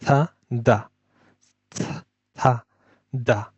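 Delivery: থা and দা with tongue tip at the teeth, softly; drilled th sounds. Shown as a demonstration. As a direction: model থা দা for the class. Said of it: "আর এটা হচ্ছে দা sound". (দাঁতে জিহ্বা রেখে থা দা)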